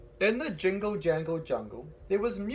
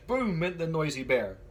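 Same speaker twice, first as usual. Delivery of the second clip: ordinary delivery, nothing marked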